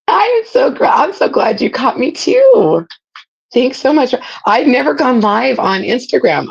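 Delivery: high, bright excited greeting. Real, not acted. Excited greeting to live viewers.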